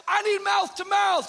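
high, loud hammed-up plea; dramatic demand. Begging his wife for mouth to mouth, loud mock plea. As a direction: overact the need for rescue. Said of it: "I'm like".

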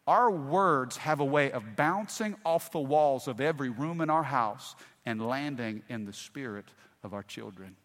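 ordinary delivery

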